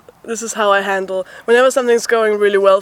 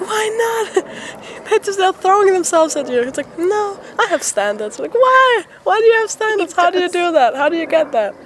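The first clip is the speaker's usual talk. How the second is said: incredulous pleading; 9 semitones higher than usual. Retold or imitated. Teasing a friend on tour for turning girls down, incredulous pleading. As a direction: beg him to explain, half laughing. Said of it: "I was like".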